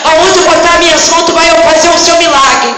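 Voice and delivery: rising, rhythmic proclamation of miracles; full-force preaching. Real, not acted. Preacher declaring healings to the church, full-force preaching. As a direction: drive it home phrase after phrase.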